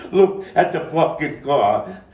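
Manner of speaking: loud, slow, high scream with Look punched; panicked yell. Acted. Friend screaming at Sammy about the car, loud and slow. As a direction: scream the warning.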